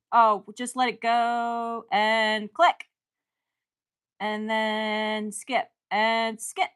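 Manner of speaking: slow and drawn out. Real, not acted. Waiting to click next, slow and drawn out. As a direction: stretch the words while clicking.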